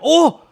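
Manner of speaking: sudden high, very loud "oh!"; startled excited yell. Real, not acted. Streamer spotting a shiny mid-sentence, startled yell. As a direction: burst out mid-sentence, real shock.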